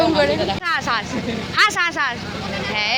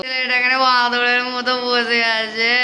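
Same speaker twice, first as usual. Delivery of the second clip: slow, drawn-out teasing singsong; 3 semitones lower, slower than usual. Shown as a demonstration. Teasing the boy sitting beside her, slow and singsong. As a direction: stretch it out to tease him. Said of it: "teasing the boy sitting there".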